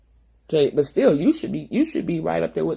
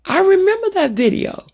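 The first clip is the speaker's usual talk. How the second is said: animated quote; 13 semitones higher, 6 dB louder than usual. Retold or imitated. Quoting the Southern practitioner.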